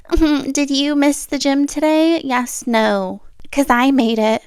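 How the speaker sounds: sing-song mock of a gym influencer; smug fitness girl. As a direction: imitate a smug fitness girly gloating. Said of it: "being like".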